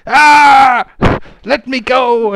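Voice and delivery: high, very loud pirate shrieks, drawn out; panicked, full-voiced wailing and cursing; horrified scream. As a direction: scream in terror at the orc. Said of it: "she screams in horror".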